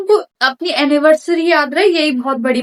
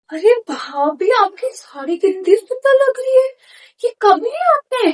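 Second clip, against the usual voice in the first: gushing admiration; 8 semitones higher than usual. Acted. Gushing over sister-in-law's saree.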